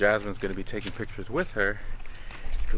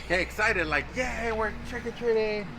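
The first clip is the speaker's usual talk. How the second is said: high cheerful coaxing; 11 semitones higher than usual. Real, not acted. Coaching kid to pose excitedly.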